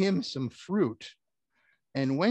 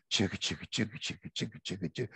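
train noise; 6 semitones lower, 6 dB quieter, much faster than usual. Shown as a demonstration. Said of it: "here comes the choo-choo train".

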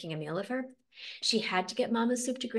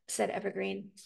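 usual speaking voice

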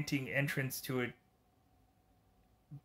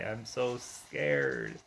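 sarcastic deep scoff; 11 semitones lower, much slower than usual. Acted. Sarcastic reply to villain.

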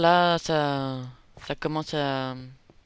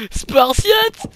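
hurlement très aigu et très fort; cri de guerre parodique; 16 dB louder than usual. Pretend. (hurlement guerrier pour rire)

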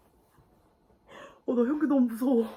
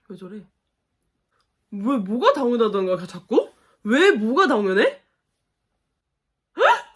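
normal talking voice

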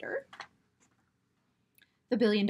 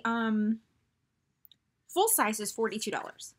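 normal talking voice

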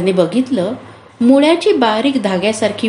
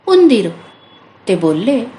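ordinary delivery, nothing marked

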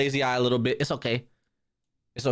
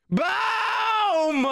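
big hype shout; boom shout. Acted. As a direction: yell it like an explosion. Countdown then dramatic blast.

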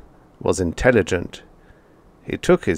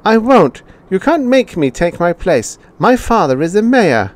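put-on high, loud child's refusal; a spoilt boy digging in his heels; mayor's son shouting back at the robot; defiant boy; 11 semitones higher, 5 dB louder than usual.

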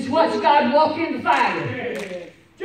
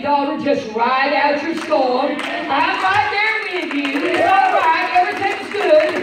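loud, high comforting preacher voice; God's comforting words, proclaimed; reassuring; 4 semitones higher, 6 dB louder than usual. Retold or imitated. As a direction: lift her up with the promise.

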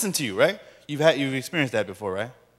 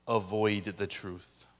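ordinary delivery

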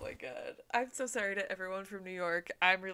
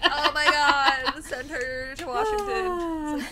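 mock gushing; 7 semitones higher, 8 dB louder, much slower than usual. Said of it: "And they're like".

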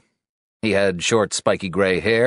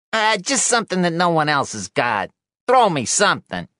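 high-pitched, wheedling newshound; wheedling reporter; 12 semitones higher than usual. Acted. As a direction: beg for a scoop in a high voice.

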